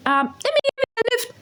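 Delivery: fast, squeaky mimicked offer; mock squeal, sped up; high-pitched offer. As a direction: rush it out way up high. Acted driver offering a lift.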